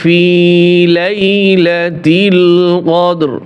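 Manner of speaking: slow, drawn-out tajweed chant; Quran recitation. Shown as a demonstration. Teacher reciting the verse to students, slow melodic chant. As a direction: sing the verse slowly with long vowels.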